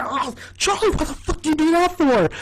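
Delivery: high-pitched outraged protest. Retold or imitated. D protests to Charlie after a volleyball to the face, high and outraged. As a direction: squeal in mock outrage. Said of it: "And she's like".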